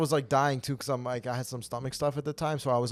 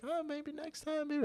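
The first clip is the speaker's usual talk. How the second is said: high, slow, soft falsetto pity; mock a sympathetic woman up high and gentle; pitying lady voice; 9 dB quieter, slower than usual. Mocking the female instructor's pity, high soft falsetto. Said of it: "She's like".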